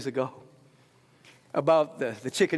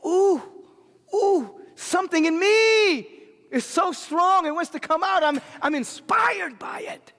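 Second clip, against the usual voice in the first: inspired gasp; 14 semitones higher, 6 dB louder than usual. Retold or imitated. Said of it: "and you say".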